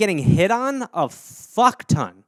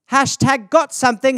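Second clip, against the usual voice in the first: higher, cheery mock-mom voice; imitating mom's posts; 6 semitones higher, 3 dB louder than usual. Mother posting online, in a mock cheery higher voice. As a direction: play a sweetly chatty mother. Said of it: "It would just be that all day".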